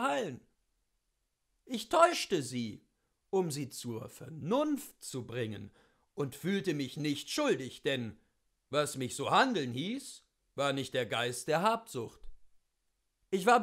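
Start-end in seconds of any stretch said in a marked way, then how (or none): none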